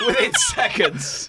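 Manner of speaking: high, squealed and drawn out; amused disbelief. Real, not acted. Teasing a friend writing down the joke, squealed disbelief. Repeats it laughing at the note-writing.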